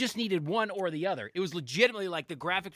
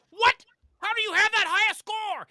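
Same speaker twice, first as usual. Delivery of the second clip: high, loud incredulous yell; mock outraged shout, pitched way up; outraged dad; 7 dB louder than usual. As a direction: imitate a dad hollering in disbelief. Retold dad outraged at score.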